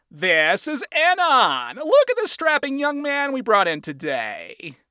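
high, drawn-out showy introduction; wacky boss. Acted. Boss introducing Anon to his employees, wacky. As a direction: present the newcomer like a showman.

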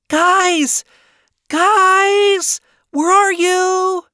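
high, loud drawn-out call. A narrator's reading. Charlie searching the crowd for her friends, calling out. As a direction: call over a noisy crowd, stretching the last words. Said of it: "Charlie called out into the sea of people".

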